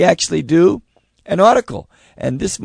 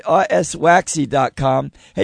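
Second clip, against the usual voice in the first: ordinary talk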